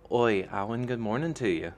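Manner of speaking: loud, high, thick Lanrine brogue calling out; cheery Lanrine greeting, loud and bright; loud Lanrine accent. A narrator's reading. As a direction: hail him loudly in a thick Lanrine accent. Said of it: "Fiona's loud and thick Lanrine accent".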